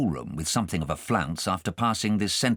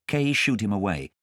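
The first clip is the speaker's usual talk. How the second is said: plain reading voice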